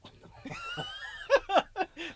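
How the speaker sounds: high shrieking laugh. Real, not acted. Laughing at own line.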